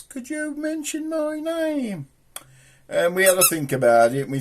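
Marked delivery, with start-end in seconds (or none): 0.0-2.1 high, slow, timid request; viewer asking. Retold or imitated. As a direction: imitate a shy viewer, lightly.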